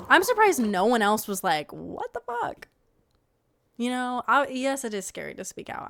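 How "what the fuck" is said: high-pitched incredulous quote; mock disbelief. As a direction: shoot the pitch up in acted disbelief. Voicing the objection nobody made.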